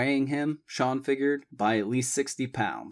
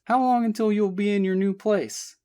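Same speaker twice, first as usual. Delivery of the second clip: put-on lightly higher young woman's voice; Abby voiced; 11 semitones higher, 4 dB louder than usual. A narrator's reading. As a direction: give Abby a bright, lifted voice. Abby's voice in story.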